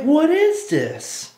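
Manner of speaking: very high, drawn-out mock bafflement; incredulous. Podcaster recalls his shock at the anime, high drawn-out incredulity. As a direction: wail it slowly in exaggerated disbelief.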